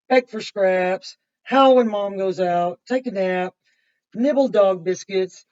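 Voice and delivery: loud, lively read-aloud of a dog's day; reading to children. Acted. Teacher reading a picture book to the class, loud and lively.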